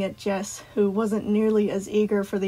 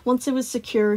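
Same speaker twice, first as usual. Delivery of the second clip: plain reading voice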